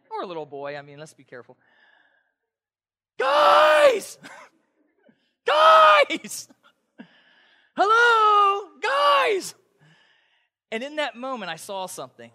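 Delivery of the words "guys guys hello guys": high, loud shouts for help into the dark; panicked screaming. Retold or imitated. Pastor reenacting calling for campers in the dark woods, panicked high shouting. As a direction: yell for your friends, scared and lost. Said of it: "I screamed like a little girl".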